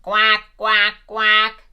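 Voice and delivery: loud quacking duck voice; a duck greeting each guest at the door with loud quacks. Acted. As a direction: quack it out brightly like a little duck. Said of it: "The pigs arrived next".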